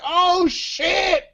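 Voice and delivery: high, drawn-out mock exclamation; imitated outburst. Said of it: "It was like".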